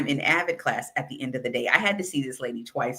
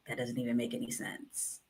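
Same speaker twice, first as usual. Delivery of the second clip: inner sarcasm; 4 semitones lower, 14 dB quieter than usual. Retold or imitated. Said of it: "In my mind".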